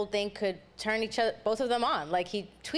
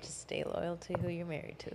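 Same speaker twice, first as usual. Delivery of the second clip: whispering; 7 dB quieter than usual. Real, not acted. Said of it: "Why are you whispering that shit?".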